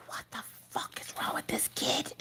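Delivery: whispered in disbelief; shocked whisper. Retold or imitated. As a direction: hush it like you're spooked by your own kid. Mom's inner reaction to creepy kid.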